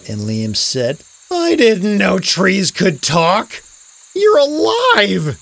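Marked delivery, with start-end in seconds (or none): 1.3-5.4 slow, raised-pitch amazement; louder and higher on the discovery; amazed. A narrator's reading. Boy finds out trees are alive, amazed and drawn out.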